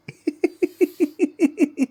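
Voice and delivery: long high-pitched giggle; real, very high tickled laugh; ticklish giggling. Real, not acted. Laughs while being tickled.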